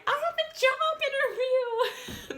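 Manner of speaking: high, slow, loud whining through laughter; mock whiny plea. Imitating the roommate pleading about her interview, whiny and high. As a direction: whine it like a desperate roommate.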